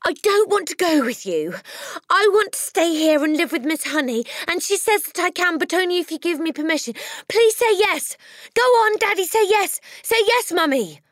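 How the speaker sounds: high, loud begging; breathless pleading. A narrator's reading. Matilda begs her parents loudly, out of breath. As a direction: plead fast and desperate. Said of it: "gasping for breath".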